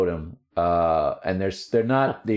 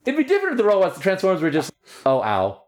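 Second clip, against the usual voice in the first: excited; 11 semitones higher than usual. Real, not acted. Ranting about gory cartoon.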